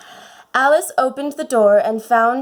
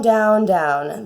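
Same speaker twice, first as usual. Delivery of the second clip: ordinary delivery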